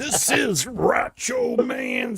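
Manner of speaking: high, slow wrestling promo yell; wrestler shout. Pretend. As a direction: bellow it like a pro wrestler.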